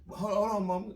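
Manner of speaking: put-on pleading kid. Boy pleading with his mom.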